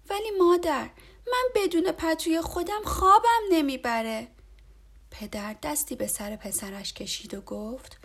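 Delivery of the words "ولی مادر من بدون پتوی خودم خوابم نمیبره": put-on high, loud whiny child voice; a boy pleading, close to tears; upset little boy. A narrator's reading. Boy pleads with his mother at bedtime, upset. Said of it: "با ناراحتی گفت".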